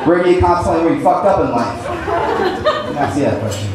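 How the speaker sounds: normal talking voice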